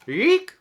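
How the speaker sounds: put-on very high, loud baby-animal squeak; squeaky rhino squeal. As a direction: squeal it like a little rhino calf. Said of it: "She made funny sounds".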